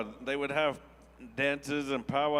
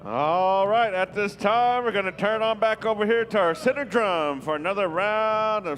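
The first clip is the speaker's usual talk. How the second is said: much louder, higher emcee call; powwow emcee; 6 semitones higher, 6 dB louder than usual. Real, not acted. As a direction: call it out big to the whole arena. Calling the center drum.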